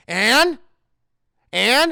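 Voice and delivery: loud, rising prompt; demanding Howard. Retold or imitated. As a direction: prod sharply for the rest of the apology. Acted host demands full apology.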